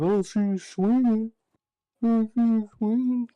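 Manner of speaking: mocking high-school bully taunt. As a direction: goad him to throw a punch. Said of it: "I remember he was like".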